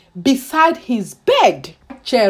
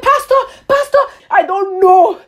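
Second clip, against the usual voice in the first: high, panicky plea, retold; reliving the scared protest to the pastor; retold frightened plea; 7 semitones higher than usual. Frightened girl pulling away from pastor.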